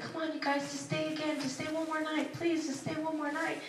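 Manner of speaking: a gentle, wheedling plea; pleading dad. Retold or imitated. Father begging them to stay.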